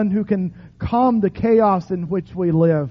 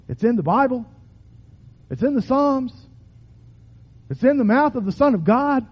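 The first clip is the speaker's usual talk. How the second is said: ordinary talk, nothing marked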